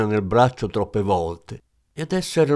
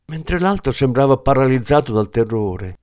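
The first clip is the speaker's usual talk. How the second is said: plain reading voice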